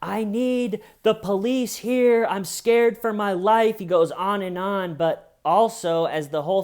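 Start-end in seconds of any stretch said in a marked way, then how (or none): 0.0-3.8 much higher, louder, slow panicked plea; hammed-up terror; scared for life. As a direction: beg the cops for help. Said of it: "on the phone with the cops saying".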